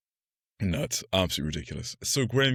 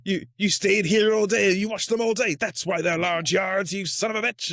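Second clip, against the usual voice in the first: much higher, louder hammed-up eureka yell; mock yelling detective; 12 semitones higher, 4 dB louder than usual. Podcaster mocks film detective yelling alone, hammed-up shout. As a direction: yell at nobody like you just solved it. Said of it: "who are you yelling at".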